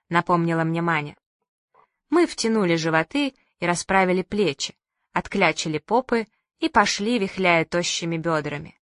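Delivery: usual speaking voice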